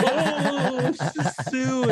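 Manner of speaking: very high squeaky mock voice with a laugh. To his friends, a very high squeaky mock "sue" with a laugh. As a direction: squeal it out greedily, high and giggling.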